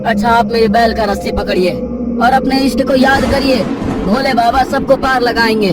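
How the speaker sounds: high, loud, urgent reassurance; helpful stranger with bullock. Acted. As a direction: take charge and hurry them to safety.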